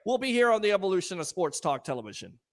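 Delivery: ordinary talk, nothing marked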